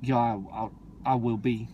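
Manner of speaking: lightly imitated low street talk; street gangster. Creed's son answers his girl, lightly imitated street gangster. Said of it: "the way he talks".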